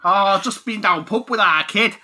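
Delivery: high, loud, drawn-out Mancunian; slow, broad Manchester pub talk; Manchester accent. Acted. Mimicking the game's talk, broad Manchester accent. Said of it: "full of that kind of talk".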